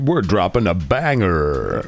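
slow, hyped radio DJ drawl; lightly imitating a hyped radio DJ. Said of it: "crazy radio D J thing".

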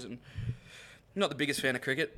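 normal talking voice

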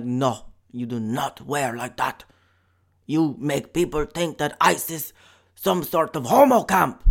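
a hint of a German villain accent as a stern terrorist; mock extremist accent. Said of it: "I'm doing the impression of ... the accent".

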